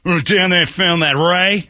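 a hint of an Aussie guide bragging back on the boat; Australian accent. Australian guide back on the boat, bragging in a light Australian accent. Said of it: "his accent".

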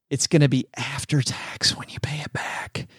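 whispered aside, like a secret; stage whisper. Acted. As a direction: lean in and whisper it to the listener. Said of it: "I like the stage whisper on a podcast".

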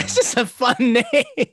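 laughing through the words; can't stop giggling at the name. Real, not acted.

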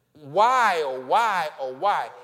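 loud wailing "why, oh, why"; mock sob, loud and pleading; demo sobbing. Shown as a demonstration. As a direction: cry it out like a broken heart. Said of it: "That's sobbing".

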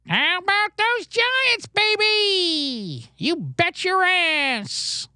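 high, slow squawking parrot. Pretend. Teaching a bird Uncle Kevin's line, high slow parrot squawk. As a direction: screech it out like a trained parrot. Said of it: "If you can get a bird to go".

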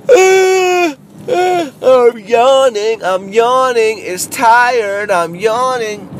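high, slow, drawn-out whining about yawning; mock high whiny yawn. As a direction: play a bored, whiny comic, stretched and high. Bored voice acted again.

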